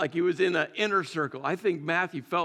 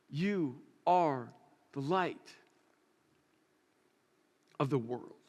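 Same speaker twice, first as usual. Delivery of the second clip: quiet solemn proclamation; 4 semitones lower, 6 dB quieter, slower than usual. Acted. Jesus voiced, solemn proclamation.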